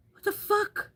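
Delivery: high-pitched startled exclamation; retold shock. Streamer retells finding glitter all over her hand, shocked. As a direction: shoot it up high in disbelief.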